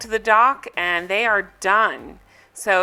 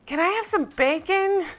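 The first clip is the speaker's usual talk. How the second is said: high-pitched imitation of a drunk guest yelling; mock yell; 9 semitones higher than usual. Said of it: "So then Dolores yells".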